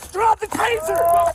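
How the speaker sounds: loud, slow barked order; shouting command. Real, not acted. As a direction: yell it as a command. Officer yelling during struggle.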